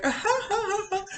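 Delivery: high, hammed-up cackling ha-ha; mock hysterical laugh. Imitating a woman laughing over her husband, mock hysterics. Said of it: "laughing hysterically".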